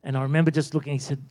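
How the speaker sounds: ordinary talk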